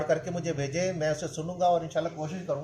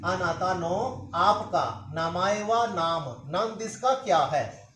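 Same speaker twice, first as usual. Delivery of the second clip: each Japanese word slowly, then its meaning; walk them through it slowly word by word; slow review with glosses; slower than usual. Shown as a demonstration. Teacher to students, slowly repeating the whole lesson. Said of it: "सारे को दोबारा repeat करता हूँ".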